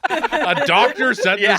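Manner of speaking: words squeezed out through laughter; can barely get it out for laughing; high, slow, laughing disbelief. Real, not acted. Laughing at doctor's photo email.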